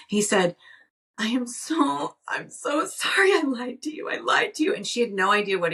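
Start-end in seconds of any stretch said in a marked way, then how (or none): 1.2-4.7 high, breaking pleading voice, fading to a whisper at the end; a son sobbing out his apology to his mom, pitched high; retold tearful confession. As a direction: voice the crying boy, pitched up and shaky with tears. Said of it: "and he was crying".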